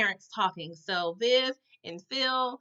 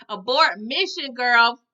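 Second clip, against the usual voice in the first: louder, slow mock alarm; urgent call out; 9 dB louder, slower than usual. Yelling at Aunt Viv to save her marriage, loud and slow. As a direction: sound the alarm at the screen.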